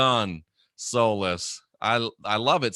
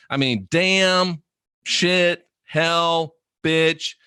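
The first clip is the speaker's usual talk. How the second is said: each cuss word punched, pitched up and slowed; slow, raised, separate punches on damn, shit, hell, bitch; emphasis words; 4 semitones higher, much slower than usual. Shown as a demonstration. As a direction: hit every word as an emphasis word. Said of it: "I like to think of it as emphasis".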